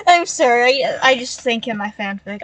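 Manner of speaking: usual speaking voice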